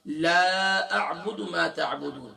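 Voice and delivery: لا drawn out long and slow; stretched la. Shown as a demonstration. Teacher reciting with لا stretched. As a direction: hold the alif of لا long. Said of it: "اگر آپ لا کو کھینچیں گے نہیں".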